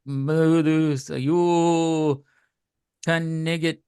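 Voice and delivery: drawn-out, hammed-up French accent; mock French accent. Co-host quoting an insult, hammed-up French accent. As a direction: taunt them in an outrageous French accent.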